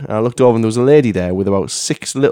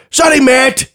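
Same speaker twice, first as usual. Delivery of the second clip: high, raised mock voice; hammed-up high woman's voice; imitated older woman; 12 semitones higher, 4 dB louder, slower than usual. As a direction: call out an apology as a flustered dog owner. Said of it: "the woman went".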